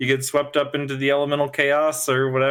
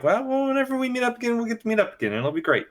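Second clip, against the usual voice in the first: higher, quick, breezy no-worries tone; carefree shrug voice; 9 semitones higher, faster than usual. Retold or imitated. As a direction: toss it off lightly like nothing matters. Voicing both characters' easygoing attitude.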